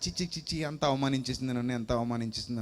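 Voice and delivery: low, soft, wounded indignation; quietly seething. Acted. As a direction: mutter the insult to yourself. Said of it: "ఎక్కడ లేని కోపం వచ్చేస్తుంది".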